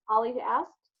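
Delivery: ordinary delivery, nothing marked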